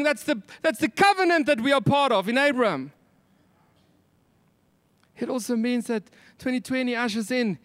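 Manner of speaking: high, fervent full-voiced preaching. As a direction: lift it up and preach it out.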